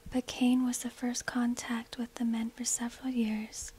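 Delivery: ordinary delivery, nothing marked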